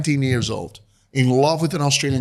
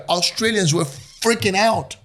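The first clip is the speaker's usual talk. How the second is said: normal talking voice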